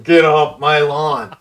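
slow, loud grumpy-neighbor impression; Clint Eastwood. Guest mocking a congressman as a bad neighbor, slow Clint Eastwood impression. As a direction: draw it out slowly, imitating a movie tough guy. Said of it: "gonna be like Clint Eastwood, mumbling".

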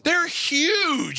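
high, stretched-out cry of alarm; appalled, high-pitched outburst; horrified exclamation. Real, not acted. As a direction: exclaim it way up high, dragging out the word. Horrified at cicada video.

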